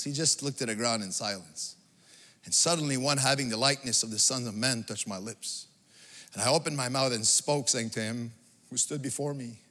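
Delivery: low, soft, solemn telling; hushed scripture reading. Acted. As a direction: drop right down as if struck speechless. Reads Daniel's speechless awe, hushed.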